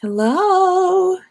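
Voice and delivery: ordinary talk, nothing marked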